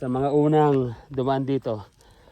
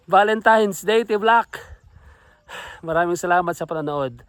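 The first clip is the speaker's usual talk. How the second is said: normal talking voice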